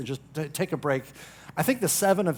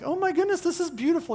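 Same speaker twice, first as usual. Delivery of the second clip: much higher, gushing guest voice; 12 semitones higher, faster than usual. Pretend. Guest admiring the staged house, high and gushing. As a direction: coo over the house like an impressed visitor. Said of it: "they look at your house and like".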